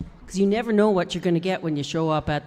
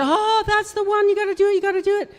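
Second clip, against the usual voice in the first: excited producer quote; 12 semitones higher, faster than usual. Retold or imitated. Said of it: "and he said".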